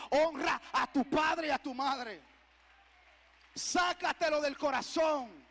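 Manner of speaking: loud, slow, drawn-out command; commanding preaching. Acted. Preacher commanding the church to honor parents, loud and drawn-out. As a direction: proclaim it slowly at full force.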